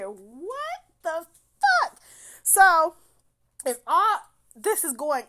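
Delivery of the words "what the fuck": slow, loud, high-pitched disbelief; incredulous outcry. Retold or imitated. Woman reacting to his rejection, drawn-out incredulity. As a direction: drag out each word, aghast.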